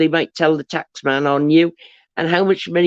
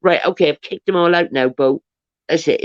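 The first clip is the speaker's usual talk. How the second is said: normal talking voice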